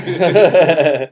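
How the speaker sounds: loud chuckling laugh. Real, not acted. Laughing at teasing.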